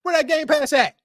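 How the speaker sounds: high-pitched mock parent interrogation; put-on nagging parent voice; scolding parent. Imagined parent refusing to buy games.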